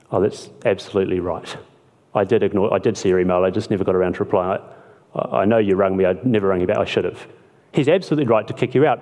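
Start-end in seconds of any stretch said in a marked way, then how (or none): 0.1-7.3 lower, sheepish owning-up voice; sheepish admission. Retold or imitated. Tenant admitting to the owner he ignored him, lower and sheepish. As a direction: mumble a guilty confession, lower.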